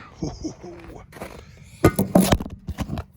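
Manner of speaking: hushed laughter; breathy whispered laugh. Real, not acted. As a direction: laugh under your breath. Laughing at freezing cold.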